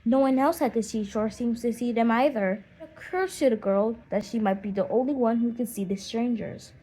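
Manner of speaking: ordinary delivery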